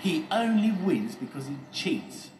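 loud, high-pitched grievance; drawn-out indignant protest; complaining. A narrator's reading. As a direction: complain loudly, voice pitched right up. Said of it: "complained Steady Eddie".

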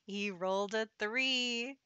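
slow gleeful laugh through the words. Real, not acted. Gloating to viewers over Devon's fatal roll, laughing. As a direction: laugh as the bad roll lands. Doomed sim rolls a three.

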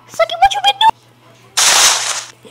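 very high and slow, cartoonish; goofy falsetto; squeaky silly voice.

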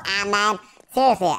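ordinary delivery

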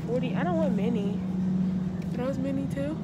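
muffled by face covering; speaking behind fabric mask; through a cloth mask. Real, not acted. Seen 2: Patterned cloth mask covers nose and mouth in every frame.

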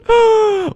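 high, loud gasp of surprise; sharp gasp. Real, not acted. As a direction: suck in air excitedly. Gasps at a find.

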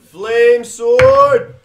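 loud proud call of the weapon; battle cry. Real, not acted. Dad calling his attack to the players, loud. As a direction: announce it like a war cry.

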